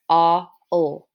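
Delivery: "Aw", pause, "Ol", each sounded alone; separate sounds. Shown as a demonstration. Teacher showing pupils aw is separate, sounded apart. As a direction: isolate "Aw" from "Ol", low and even. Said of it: "aw is separate".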